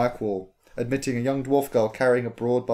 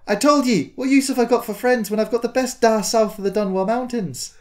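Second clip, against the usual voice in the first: put-on higher, louder child voice; dwarf girl; 10 semitones higher, 3 dB louder than usual. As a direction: sweetly argue with your da. Dwarf girl answering father.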